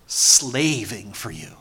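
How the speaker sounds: low, slow, dragged out, "slaving" falling; sulky grudging complaint from the older son; resentful son drawling his grievance; bitter son. Acted.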